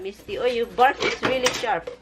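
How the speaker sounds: usual speaking voice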